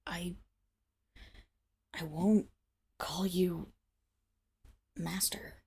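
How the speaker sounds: hushed, stubborn refusal; quiet defiance under her breath; defiant whisper. Acted. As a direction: whisper it through the collar's pull.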